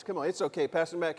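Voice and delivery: ordinary talk, nothing marked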